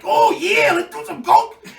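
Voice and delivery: very high, loud excited yell; mock eager shout. Agreeing to the wrestler's offer, mock eager yell. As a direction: shout back with hammed-up enthusiasm. Acts own eager reply.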